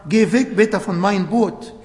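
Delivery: usual speaking voice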